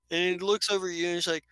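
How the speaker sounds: ordinary talk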